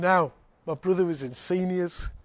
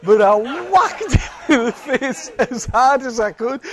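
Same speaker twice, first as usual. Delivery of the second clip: laughing, higher, louder and slower; 6 semitones higher, 10 dB louder, slower than usual. Real, not acted. Preacher admitting he hit the bully, laughing. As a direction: giggle through the confession.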